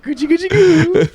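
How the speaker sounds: put-on high, slow laughing baby talk. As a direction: coo it like talking to a baby. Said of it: "doing the baby voice".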